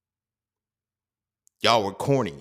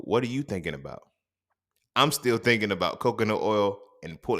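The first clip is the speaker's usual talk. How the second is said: ordinary talk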